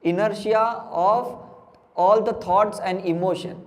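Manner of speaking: very slow, word-by-word delivery; drawn-out teaching. Real, not acted. Teacher prompting the class with the key term, drawn out. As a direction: stretch out each word, letting it hang for the class.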